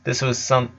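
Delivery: ordinary delivery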